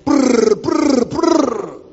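loud trilled bursts of machine-gun fire; high rolled-tongue gun rattle; imitated gunfire. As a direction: roll out three hard gun bursts. Imitates gun firing to prove bullets.

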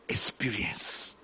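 breathy hushed whisper, slowed right down. Retold or imitated. Woman recalling near-death bliss, whispered slowly. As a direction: let the last word fade into a whisper.

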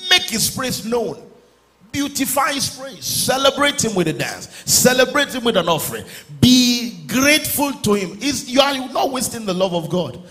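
high-pitched, impassioned exhortation; fervent preaching. Real, not acted. Preacher urging the congregation to celebrate God, fervent preaching. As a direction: urge them to praise in a high, ringing voice. Preaching verses at full force.

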